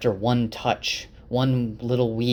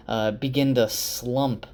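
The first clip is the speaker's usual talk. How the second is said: ordinary delivery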